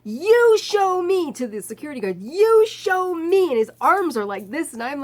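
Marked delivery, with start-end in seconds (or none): 0.0-1.3 slow, loud, high demand; the screamer confronting the security guard; angry shout. Retold or imitated. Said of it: "he's going". 2.2-3.5 slow, loud, high demand; the screamer confronting the security guard; angry shout. Retold or imitated. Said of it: "he's going".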